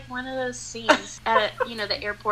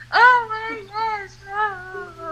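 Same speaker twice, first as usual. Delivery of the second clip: tearful cry; 9 semitones higher, 5 dB louder, much slower than usual. Retold or imitated. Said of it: "broke down and started crying".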